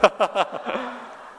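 high burst of laughter; amused laugh. Real, not acted. Amused by the pun on his name. (말장난에 터진 높은 웃음)

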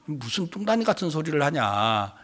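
low, soft mock grumble; dismissive. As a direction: wave away nonsense in a low voice. Sceptical disciples dismiss the news. (낮고 작게 타박하는 흉내)

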